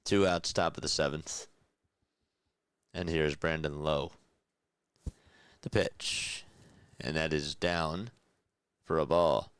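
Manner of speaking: live baseball announcer calling the pitch; play-by-play call. Acted. Streamer calling the game for chat, in announcer voice. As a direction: call it like a broadcaster in the booth.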